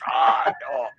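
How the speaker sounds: ordinary delivery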